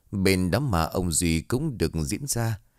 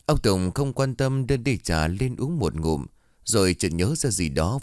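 usual speaking voice

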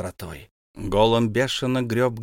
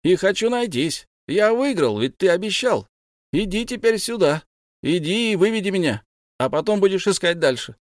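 high, raised insistent call; 8 semitones higher, 3 dB louder than usual. A narrator's reading. Bilbo insists Gollum lead him out, calling loudly. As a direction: demand he come.

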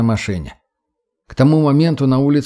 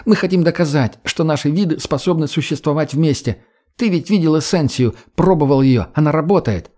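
higher-pitched, eager character voice; earnest young woman; 4 semitones higher than usual. Acted. Redhead pitching coexistence to the boy, eager conviction. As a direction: persuade with conviction, pitch raised.

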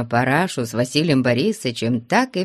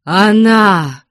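loud, higher outburst; frantic cry; 6 semitones higher, 9 dB louder than usual. A narrator's reading. Father spots his runaway daughter from the window, frantic cry. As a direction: cry out as if beside yourself. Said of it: "крикнул он не своим голосом".

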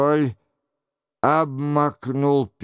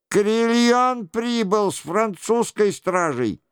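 raised, high-pitched alarm; urgent cry; 6 semitones higher than usual. A narrator's reading. Monk reports guards threatening the doors, crying out. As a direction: call out the news high and urgently. Monk's alarm, then captain's shout.

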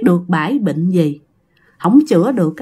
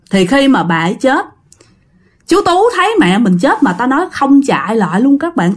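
ordinary delivery

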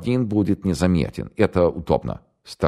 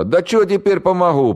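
put-on much higher, louder panicky young man; scared radio operator; 5 dB louder than usual. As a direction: blurt it out in terror. Голос радиста. (испуганный пленный радист, высоко и громко)

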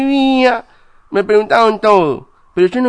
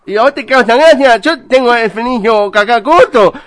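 worked up; 2 semitones higher, 4 dB louder than usual. Acted. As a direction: get touchy at being laughed at. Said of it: "No se ponga nervioso".

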